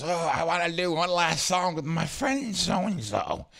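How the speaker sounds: put-on high, slow, slurry drunk woman; drunk partygoer. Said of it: "Comes up and goes".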